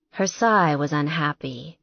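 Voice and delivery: ordinary delivery, nothing marked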